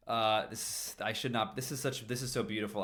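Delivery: ordinary delivery, nothing marked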